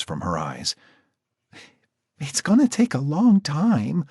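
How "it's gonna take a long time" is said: put-on high, subdued girl; a touch of tearful. Marcy warning Jack, high and subdued. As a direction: say it drained after crying.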